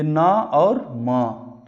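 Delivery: ना और म spelled slowly, sound by sound; slow spelling. Shown as a demonstration. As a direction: break the word into its letters. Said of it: "spelling करके पढ़ेंगे". (धीमे हिज्जे)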